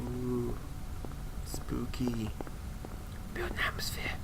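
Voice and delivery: breathy spooky whisper; eerie whisper. Pretend. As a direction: whisper it like a ghost story. Spooky atmosphere game commentary.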